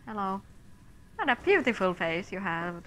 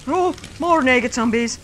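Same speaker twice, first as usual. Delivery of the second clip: loud, higher frustrated cries; fed-up shouting at the horde; exasperated yelling; 3 semitones higher, 5 dB louder than usual. Real, not acted. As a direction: shout them down in annoyance. Fighting zombies.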